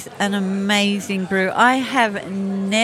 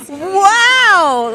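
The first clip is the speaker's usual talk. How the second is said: loud high astonished wow; reenacted wow; 9 semitones higher, 9 dB louder than usual. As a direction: blurt it out as if amazed at the first sip. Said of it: "like that".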